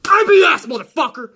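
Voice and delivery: hammed-up rage at a troll; furious rant. Real, not acted. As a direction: go back on the attack. Ranting at commenter.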